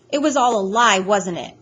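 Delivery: loud accusing question; upset accusation. A narrator's reading. Boy accusing the doctor of lying, upset.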